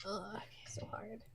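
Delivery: whispered groan. Real, not acted. Agonizing over choice.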